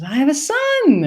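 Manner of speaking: high, falling proud announcement; high proud old lady. Retold or imitated. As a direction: announce it like a proud elderly mother. Woman's voice retold.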